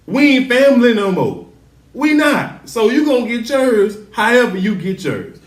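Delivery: riled-up viewer ranting at the show; heated outburst. Real, not acted. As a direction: get worked up and declare it. Heated rant at TV character.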